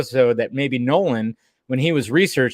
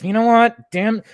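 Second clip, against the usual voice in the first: higher-pitched mock exasperation; frustrated; 8 semitones higher than usual. Imitating the Commissioner fed up with Batman vanishing, frustrated. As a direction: act fed up, pitch jumping up. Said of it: "He was actually frustrated".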